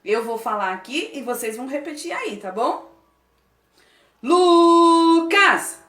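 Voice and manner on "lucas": loud, slow military chant; like a soldier. Shown as a demonstration. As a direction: bark each phrase like a drill soldier. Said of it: "você vai falar igual um soldado". (alto, como um soldado)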